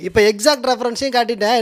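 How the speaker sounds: ordinary talk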